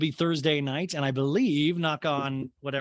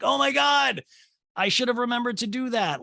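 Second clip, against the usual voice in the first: mock panic; 10 semitones higher, 4 dB louder than usual. Imagined self realising forgotten note.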